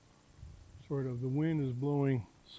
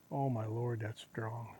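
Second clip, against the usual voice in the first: whispering; 4 semitones lower, 6 dB quieter than usual. Real, not acted. Strong deer smell hits.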